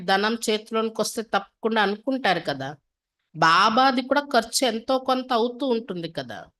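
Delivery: usual speaking voice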